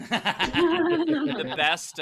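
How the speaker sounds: laughing high. Real, not acted. Laughing start.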